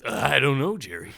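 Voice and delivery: loud, hammed-up sitcom neighbor impression; loud opening, dropping on the name; Kramer. Co-host breaks out a sitcom impression to outdo British accents, Kramer voice. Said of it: "I'm breaking out the Kramer".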